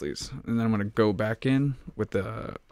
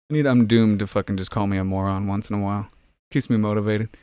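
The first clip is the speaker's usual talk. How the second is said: ordinary delivery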